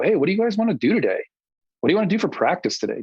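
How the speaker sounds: high, fast, mocking imitation of clueless players. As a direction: parody players who have no idea what to practise. Mocking players asking what to do.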